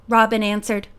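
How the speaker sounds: ordinary delivery, nothing marked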